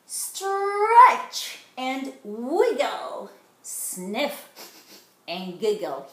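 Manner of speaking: slow, stretched sing-song chant; sing-song nursery chant; playful rhyme. A narrator's reading. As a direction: bounce the refrain for little ones.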